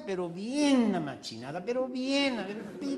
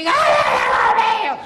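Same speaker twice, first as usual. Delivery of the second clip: put-on much higher, louder child's whine; little boy voice; 14 dB louder, slower than usual. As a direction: whine like a scared toddler.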